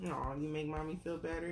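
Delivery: usual speaking voice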